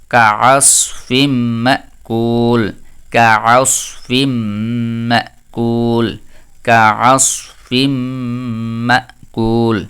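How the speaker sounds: কাআসফিম মাকুল drawn out very slowly; slow Quranic recitation. Shown as a demonstration. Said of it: "recitation demo". (ধীরে তিলাওয়াত করে)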